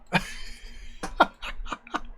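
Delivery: put-on airy drunken chuckle; wheezing tipsy laugh; drunken breathy cackle. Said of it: "The drunken gnome says".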